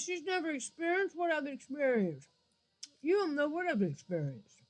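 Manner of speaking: high, hammed-up whiny outrage; mock offended whine. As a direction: play the offended viewer, overdone. Mocking offended viewers' outcry.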